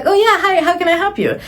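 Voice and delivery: high, bright customer service greeting; chirpy receptionist voice; cheerful clerk voice. Retold or imitated. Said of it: "she was like".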